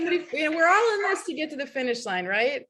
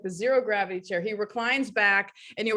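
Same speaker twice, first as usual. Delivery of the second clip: ordinary talk, nothing marked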